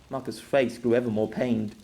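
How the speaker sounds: plain reading voice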